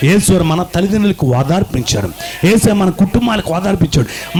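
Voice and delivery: normal talking voice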